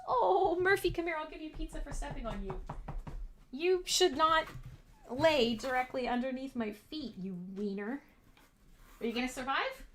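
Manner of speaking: quiet tender dog talk; comforting the dog. Real, not acted. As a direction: gently soothe your hurt dog.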